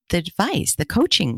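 usual speaking voice

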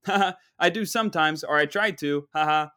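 mock good-natured chuckle around the words; imitated polite laugh, making light of it. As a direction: play a bartender laughing off a dig. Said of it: "The bartender laughs it off".

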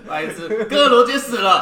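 hammed-up spoiler shout through laughter; mock spoiler yell. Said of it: "大喊".

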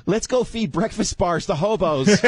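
high, bubbly socialite voice; high chirpy woman voice. Acted. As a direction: chirp it eagerly. Acting the fiancée.

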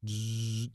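buzzing imitation of a window motor; electric window sound. Shown as a demonstration. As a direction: hum a car window rolling down. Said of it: "that's my electric window sound".

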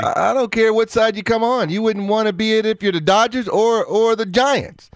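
heated protest. Real, not acted. Arguing tie would be awful.

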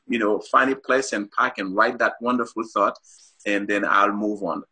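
normal talking voice

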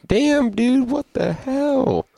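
high, slow, stretched disbelief; amazed. Real, not acted. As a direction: drag out an awed what the hell. Amazed by teleporting hero.

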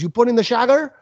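bad Italian accent. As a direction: imitate an Italian asking, "sugar" sounding like "shagger". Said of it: "sugar is what he was getting at".

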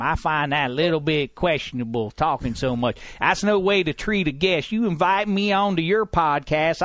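lightly put-on grumbling old-timer, rising up to the end; a hint of a cranky old man complaining; put-on accent, lightly done; Handsome Hank voice. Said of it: "Handsome Hank having a conversation".

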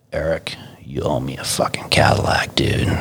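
low, whispered acted threat; whispered mock menace. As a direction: play the driver's quiet menace in a whisper. Voicing the menacing driver in the story.

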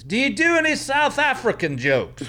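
a heckler calling from the dark. Retold or imitated. Heckler shouting from the darkness, high put-on voice. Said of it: "I hear this".

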